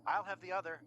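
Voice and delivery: a hint of the aristocrat; aristocratic voice. A narrator's reading. Aristocrat claiming the other eyeball from across the way, lightly aristocratic. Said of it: "came an aristocratic voice".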